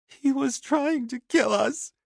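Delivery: very high, slow, tearful plea; sobbing woman. A narrator's reading. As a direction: sob it out, desperate. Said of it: "she sobbed".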